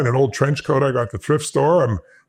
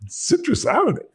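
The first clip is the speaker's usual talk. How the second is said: 1 incredulous question. Retold or imitated. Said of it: "So she says".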